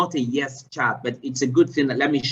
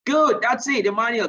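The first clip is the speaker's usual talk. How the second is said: sudden high, loud "Good"; bursting with delight; excited praise; 9 semitones higher, 4 dB louder than usual. Real, not acted. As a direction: cheer the right answer. Praising student's correct answer.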